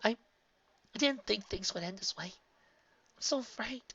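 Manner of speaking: a trembling confession; frightened. A narrator's reading. Twilight confessing her fear to Spike.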